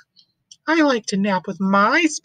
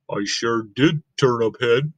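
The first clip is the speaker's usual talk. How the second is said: much lower, dull Gerald voice; deep elephant voice; 12 semitones lower than usual. Acted. Gerald telling Piggy he is not napping, deep.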